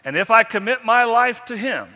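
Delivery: high, loud proclamation of faith; booming creed line; loud emphatic recital. Shown as a demonstration. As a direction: ring it out like a vow.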